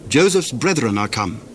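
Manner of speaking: ordinary delivery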